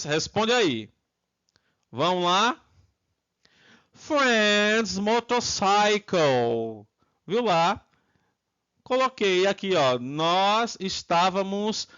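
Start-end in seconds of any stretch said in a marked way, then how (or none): 3.9-6.9 drawn-out, careful teacher's model; slow, stretched-out English answer; slow model pronunciation. Shown as a demonstration. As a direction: say it slowly for students to copy.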